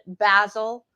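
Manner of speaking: BASIL with a short a, rhyming with "dazzle"; English pronunciation. Shown as a demonstration. Showing how the English say it, BASIL as "BAZ-il". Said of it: "The English pronunciation".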